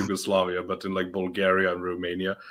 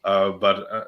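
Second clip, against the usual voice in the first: ordinary talk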